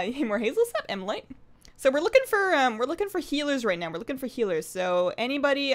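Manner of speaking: ordinary talk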